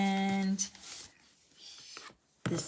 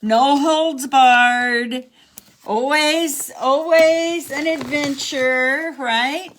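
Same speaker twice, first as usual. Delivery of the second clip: loud, slow, higher mock announcer; playful promo voice; hammed-up tagline; 5 semitones higher, 7 dB louder, much slower than usual. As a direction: sell the slogan big and slow. Joking show tagline.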